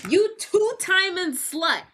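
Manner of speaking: high-pitched retold accusation; imitating an outraged ex, pitched well up; mock outrage. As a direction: act out a jilted man's indignant outburst. Dean accuses Max of cheating.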